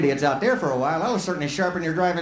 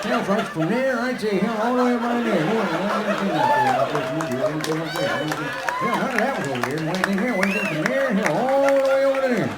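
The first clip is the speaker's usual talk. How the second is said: put-on higher, rambling old-timer voice; old granddad rambling; 3 semitones higher than usual. Granddad in the passenger seat reminiscing, old-timer ramble. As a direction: grumble on like a grandpa who won't stop. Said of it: "he's gonna be over on the passenger side over there going".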